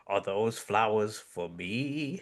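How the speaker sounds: slow, drawn-out villain's question; lightly voiced count, stretching the words; Count Draculon. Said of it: "Count Draculon's like".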